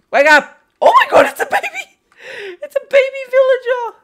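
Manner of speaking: high, loud squeal of delight; excited squeal. Real, not acted. Player discovering a baby villager, squealing with delight. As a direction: gush over something adorable, shrieking.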